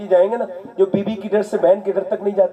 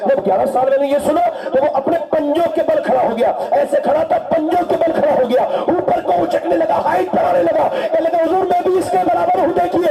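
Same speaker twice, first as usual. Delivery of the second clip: high, building preacher's storytelling; rising sermon fervour; 9 semitones higher than usual. Acted. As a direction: lift the crowd with the boy's eagerness. Preacher rising in pitch telling boy's plea.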